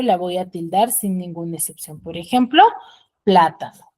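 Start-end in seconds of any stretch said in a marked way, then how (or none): 3.2-3.9 plátano with the first syllable pushed loud, the rest falling; stress on first syllable. Shown as a demonstration. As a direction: punch the PLA in plátano. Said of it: "tiene la mayor fuerza de voz". (plátano con la fuerza de voz en PLA)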